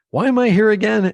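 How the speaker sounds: high, quick New York question; baffled New Yorker, rushed; New York accent. Retold or imitated. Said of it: "thick New York accent".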